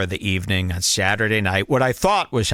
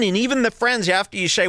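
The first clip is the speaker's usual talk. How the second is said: ordinary talk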